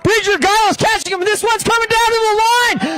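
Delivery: rising into a frantic finish call; excited. Real, not acted. Race finish, commentator climaxes.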